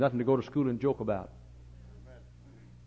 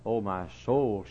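normal talking voice

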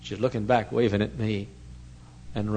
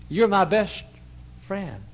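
put-on high little-girl voice, lifted and louder; childlike high voice; 10 semitones higher, 5 dB louder, slower than usual. Preacher voicing the six-year-old girl, high and childlike. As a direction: pitch it up like a small girl.

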